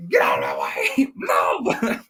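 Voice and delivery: comic shout with a wild pitch jump; mock frantic yell. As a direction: yell like someone barging through in a panic. Acted fleeing character yelling at soldiers.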